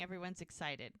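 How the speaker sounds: ordinary delivery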